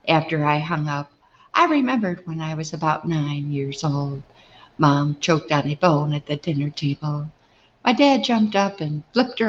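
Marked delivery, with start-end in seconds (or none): none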